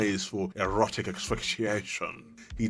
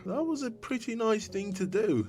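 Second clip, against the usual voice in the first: much higher, squeaky mock voice addressing Ruta Baga; playful put-on voice; 10 semitones higher than usual. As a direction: pitch up into a teasing cartoon voice. Talking to a character in odd voice.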